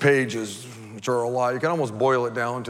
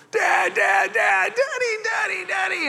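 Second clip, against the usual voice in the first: high-pitched mock child's cries; screaming kid. Preacher as boy calling Dad over a bee, mock screaming. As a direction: imitate a panicking little kid calling out. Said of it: "the kid starts screaming".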